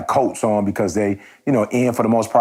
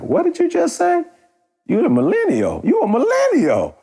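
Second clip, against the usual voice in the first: high, far-off squeal of disbelief; mock disbelief. Teasing the server who says she signed up for this, in a high mock-shocked squeal. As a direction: shriek it like you can't believe your ears. Mock incredulous quote of himself.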